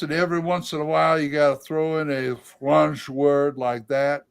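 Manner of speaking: Cajun accent. Shown as a demonstration. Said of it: "is Cajun".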